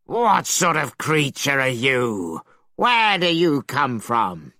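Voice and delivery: slow, looming dragon voice; dragon shouting. A narrator's reading. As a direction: demand it suspiciously. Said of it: "the dragon leapt up and shouted".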